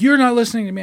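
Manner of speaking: mock annoyed customer protest; mock angry caller. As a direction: imitate the caller's frustration. Caller pushing back at him.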